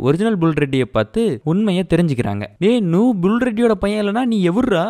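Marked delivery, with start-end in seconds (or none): 2.6-4.8 higher-pitched, acted shock at an impostor; mock indignant demand. Heroine's family confronting the fake son, mock indignant shock. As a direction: confront the impostor, lightly hammed up.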